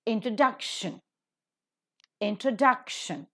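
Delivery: "Introduction" stressed on DUC; stress before the suffix. Shown as a demonstration. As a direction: hit DUC in each "Introduction". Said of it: "The is given stress".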